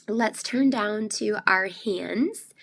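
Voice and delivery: normal talking voice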